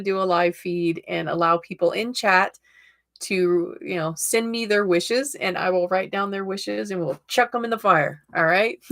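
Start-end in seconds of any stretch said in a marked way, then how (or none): none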